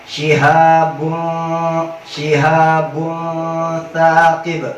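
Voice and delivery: slow drawn-out "Shihabun, shihabun thaqib" with the nun hidden midway; slow tajwid recitation. Shown as a demonstration. Teacher demonstrating ikhfa for students, slowly drawn. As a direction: stretch each syllable like Quran recitation. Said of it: "bacanya kayak tadi".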